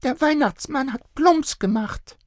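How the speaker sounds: put-on high child voice. A narrator's reading. Little girl telling parents what happened, high. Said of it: "machte Luca den Weihnachtsmann nach".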